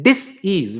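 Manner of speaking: "disease" slowly broken as "dis... ease"; split into dis-ease. Shown as a demonstration. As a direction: say "disease" as two words, "dis" and a drawn-out "ease". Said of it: "when you are not at ease". ("disease" को तोड़कर "डिस-ईज़" बोलना)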